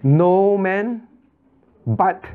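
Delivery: "No man" and "but" drawn out and set apart; emphasis on each key word. Shown as a demonstration. Teacher counting off emphasised words for students, emphatic. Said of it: "how many times could the Lord emphasize".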